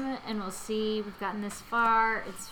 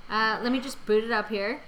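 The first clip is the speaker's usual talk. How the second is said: ordinary talk, nothing marked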